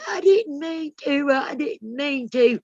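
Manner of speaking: high, slow blubbering plea; imitating a sobbing teacher begging; mock bawling. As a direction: whimper it like a crying teacher caught out. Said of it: "just a bawling".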